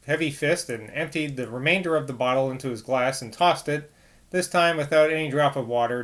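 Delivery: ordinary delivery, nothing marked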